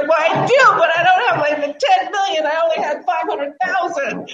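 high-pitched mock crying; retold tearful protest. Client protesting to her friend about money, imitated tearful whine. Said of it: "And I'm crying".

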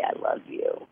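softly whispered, no voice; a mock-sweet whispered I love you. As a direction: breathe the words out tenderly. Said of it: "I just have to sit and say".

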